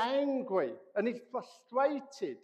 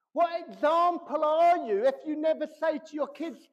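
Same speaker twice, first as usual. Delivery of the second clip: higher, louder preacher's call; raised preaching voice; 5 semitones higher, 6 dB louder than usual. Real, not acted. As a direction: drive the question home from the pulpit. Preaching at full force.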